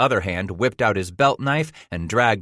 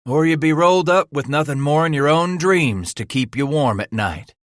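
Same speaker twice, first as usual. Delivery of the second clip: a big trapper schooling the greenhorn; rough mountain man; 7 semitones higher, 4 dB louder than usual. A narrator's reading. Cooper continues his lecture.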